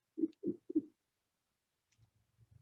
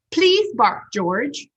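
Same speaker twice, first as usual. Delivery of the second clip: high, loud please; coaxing vet; 5 semitones higher, 6 dB louder, much slower than usual. Acted. Vet asking George to bark, high and loud. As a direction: coax the pup politely but firmly. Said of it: "said the vet".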